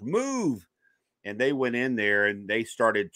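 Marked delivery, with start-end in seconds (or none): 0.0-0.7 pushing-past order. Retold or imitated. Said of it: "they just burst past the doctors".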